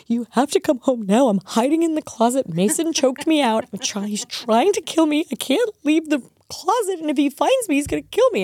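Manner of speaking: put-on high frantic child pleading; panicked kid voice. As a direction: voice a scared little boy. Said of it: "and he's like".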